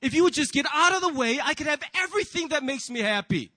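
fed-up snap back at conscience; irritated retort. Acted. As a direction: brush off the nagging voice angrily. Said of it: "and you're like".

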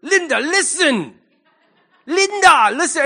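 high, loud mock-scolding child; insistent little boy. Little boy in a video telling Linda off, insistent. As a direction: imitate a small boy lecturing a grown-up. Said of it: "that video of that little boy".

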